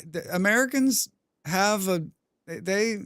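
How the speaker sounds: normal talking voice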